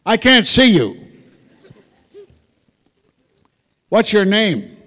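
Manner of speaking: loud, high call into the room. Real, not acted. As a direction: call out to someone you can't see. Calling out to unseen caller.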